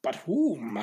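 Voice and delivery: put-on low, fading old-master voice; Yoda impression. Yoda lecturing the younglings, low and fading. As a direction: croak it like a tiny old sage.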